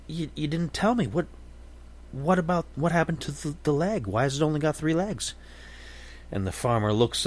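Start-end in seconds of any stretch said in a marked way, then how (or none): 0.1-5.3 curious businessman. Acted. Businessman finally asks about leg.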